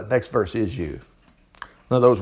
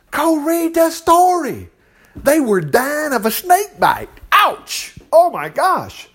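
much higher, slow emphatic; animated high; 11 semitones higher, slower than usual. Real, not acted. Preacher urging listeners to read the snake story, high and animated. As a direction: tell it high and vivid.

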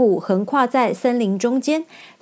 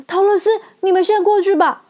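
urgent lion; 10 semitones higher, 4 dB louder than usual. Acted. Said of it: "lion urges friends".

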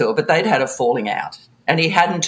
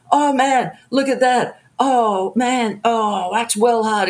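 impressed spot operator; 7 semitones higher, slower than usual. Retold or imitated. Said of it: "He's like".

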